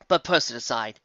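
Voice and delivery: usual speaking voice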